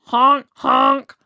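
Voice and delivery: loud honking goose call. Acted. Goose honking loudly in agreement with Sadie. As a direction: honk like a goose. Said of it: "A Canada goose said".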